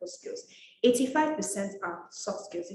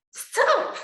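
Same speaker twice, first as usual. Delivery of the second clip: a high, loud, sharp stop; 13 semitones higher, 6 dB louder than usual. Pretend. Talking to followers who misuse slang, a high sharp stop. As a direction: cut them off with a shrill-free high stop.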